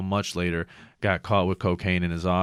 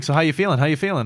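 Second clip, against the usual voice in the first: loud, higher bedside cheer; over-peppy doctor; 8 semitones higher, 5 dB louder, faster than usual. Acted. Doctor checking on his patient, over-peppy. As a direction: play the wired doctor breezing in. Acting doctor's breezy visit after surgery.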